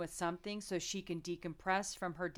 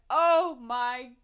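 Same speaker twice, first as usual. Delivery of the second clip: loud, exaggerated groan of disbelief; mock exasperation; 3 semitones higher, 12 dB louder than usual. Husband reacting to nails-on-a-chalkboard overacting, mock exasperation. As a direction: blurt it out, hammed-up and fed up.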